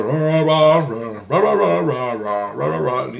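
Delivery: mock speech in nonsense rah-rahs, swelling then fading; imitated droning speech. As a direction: imitate someone talking on and on. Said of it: "I can still hear him talking".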